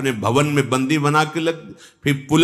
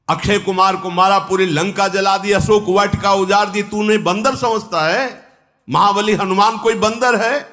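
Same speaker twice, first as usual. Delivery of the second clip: quickening, raised outburst of disbelief; indignant rebuke; 7 semitones higher, 4 dB louder than usual. Acted. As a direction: reel off the deeds in rising outrage. Angad rebukes Ravan over Hanuman. (ऊँचे स्वर में आक्रोश भरी फटकार)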